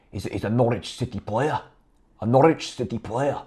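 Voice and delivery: put-on a light Scottish accent, quiet; Alex Neil impersonation. A fan imitating the manager answering reporters, mild Scottish accent. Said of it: "That was me being Alex Neil".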